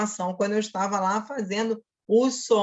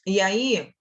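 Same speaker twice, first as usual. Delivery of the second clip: normal talking voice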